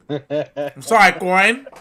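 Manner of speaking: loud, slow, drawn-out sorry that rises at the end; loud mock apology. Hams up a loud apology to Corin, high and drawn out.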